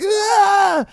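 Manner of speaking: high, loud shriek at the wall; mock shriek. Gym guy with hands on the wall, mock shriek. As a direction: let out a sudden high shriek. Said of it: "just shrieked like".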